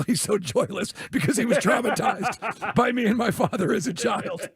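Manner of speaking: normal talking voice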